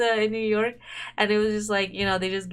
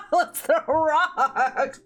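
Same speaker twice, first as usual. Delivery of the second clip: dragged out through helpless laughter; cracking up mid-line, barely getting the words out; laughing; 7 semitones higher, 4 dB louder, much slower than usual. Real, not acted. Reading the player's line aloud, slowed and laughing.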